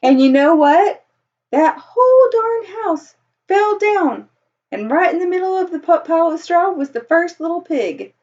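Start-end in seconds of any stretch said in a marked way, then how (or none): 1.5-4.3 dramatic excitement. Acted. Dramatic storytelling climax.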